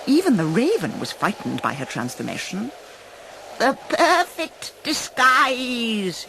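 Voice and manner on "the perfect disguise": high, slow witch croak; satisfied hag croak; old hag croak. A narrator's reading. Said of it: "she croaked with satisfaction".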